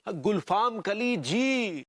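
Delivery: गुलफाम कली जी stretched slowly; drawn-out echo. Acted. Man repeating her corrected name, slow drawn-out गुलफाम कली जी. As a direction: echo गुलफाम कली जी with long, obedient vowels.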